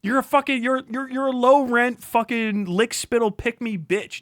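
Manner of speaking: ordinary talk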